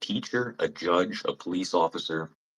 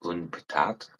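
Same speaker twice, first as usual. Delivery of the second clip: "Une patate?" repeated softer with a puzzled rising tune; mock disbelief; 7 dB quieter than usual. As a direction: echo "Une patate?" quietly, baffled, rising at the end. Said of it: "Why don't you just fucking say potato".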